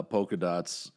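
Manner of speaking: ordinary talk, nothing marked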